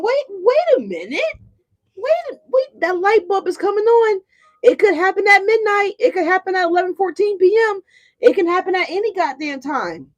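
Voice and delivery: high, excited wait a minute; sudden realization. Retold or imitated. Viewers voiced realizing their worth, high and excited. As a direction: voice jumping up as it dawns on them. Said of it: "this is y'all saying".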